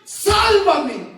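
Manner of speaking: loud, high plea for rescue; crying out. Acted. Peter sinking, begs the Lord, crying out. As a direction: shout it long, as one going under. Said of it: "clamó y le dijo".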